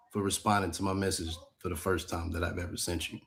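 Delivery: normal talking voice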